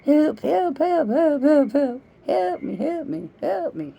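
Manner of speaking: high, squealing cries of pain; mock-wail for help like someone covered in ants; ant-bitten victim. Woman acting out ant bites, high hammed-up cries for help. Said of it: "I say that pretty good".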